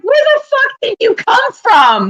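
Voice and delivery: high, slow incredulous jab; mock disbelief at his outfit. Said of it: "my brother's wife's like".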